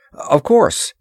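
hurried, eager-to-comply assent; hasty assent. A narrator's reading. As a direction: agree in a hurry. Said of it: "he assented hastily".

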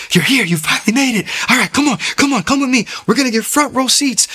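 high, loud, eager welcome; excited greeting. Retold or imitated. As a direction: hurry them in to the front, thrilled. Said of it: "this guy was so excited".

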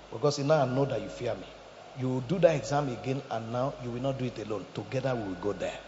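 gentle voice of God. Acted. God reassuring the student.

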